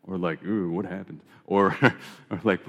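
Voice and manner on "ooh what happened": soft, sympathetic "Ooh, what happened"; concerned. Shown as a demonstration. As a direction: ask gently, full of concern. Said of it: "depending on the inflection".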